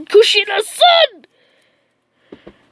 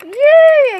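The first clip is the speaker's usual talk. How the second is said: excited yay; 8 semitones higher, 7 dB louder than usual. Acted. Naruto cheering.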